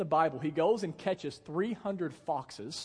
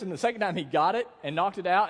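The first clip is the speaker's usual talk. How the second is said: ordinary delivery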